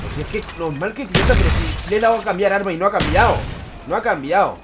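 loud, high exasperated complaint; angry rant. Real, not acted. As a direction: rage at the controls. Gamer blaming the weapon switch.

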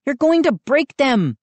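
high-pitched anxious warning; warning girl. A narrator's reading. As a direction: scold in a high girl's voice. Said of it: "Mindy warned".

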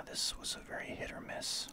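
usual speaking voice

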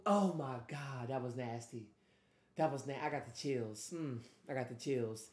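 soft, low, savoring disbelief; hushed awe. Real, not acted. Listener with chills from the lyric, hushed and low. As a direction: drop down, stunned by how good it was. Awed after hearing the lyric.

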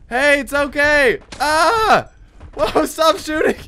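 high, loud protest; alarmed pleading. Real, not acted. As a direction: beg a shooter to stop. Pleading while being shot.

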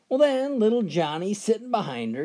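normal talking voice

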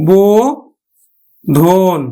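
বোধন stretched very slowly, bo-dhon; teacher models বোধন slowly; drawn-out demo. Shown as a demonstration. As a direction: draw out বোধন. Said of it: "উচ্চারণ করবো আমরা কিন্তু".

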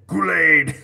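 a big booming mock shout; a loud, hammed-up yell; Kool-Aid Man shout.

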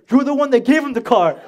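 loud, high retold scolding; mad parent. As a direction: reenact a parent throwing the blame back. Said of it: "They were mad at me".

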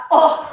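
disgusted gag sound; retching oh; gagging groan. Retold or imitated. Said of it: "it made me gag".

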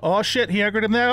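normal talking voice

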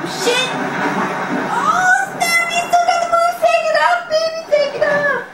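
very high, loud frantic cheers; a hint of laughter in the cheering; excited yelling. Real, not acted. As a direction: shout at the screen to make him run. Cheering Snake's moves.

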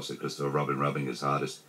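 ordinary delivery

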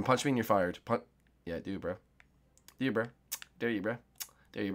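ordinary delivery

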